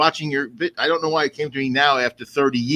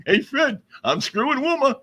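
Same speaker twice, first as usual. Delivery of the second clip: a hint of Barney, higher; light cartoon neighbour voice, joking; 7 semitones higher, 4 dB quieter than usual. Cohost jokes as Barney about Wilma, mildly voiced. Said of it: "you sound like Barney there".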